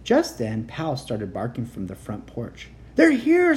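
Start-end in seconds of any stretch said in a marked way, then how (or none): 2.9-3.6 much higher, louder panicked cry; frightened cry. A narrator's reading. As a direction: scream the warning in terror. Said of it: "Sammy cried".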